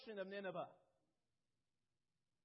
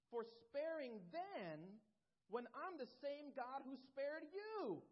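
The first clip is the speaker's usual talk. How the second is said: raised preaching voice of God reasoning with Jonah; God pleading with Jonah; 4 semitones higher than usual. Acted. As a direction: plead as God to a stubborn prophet.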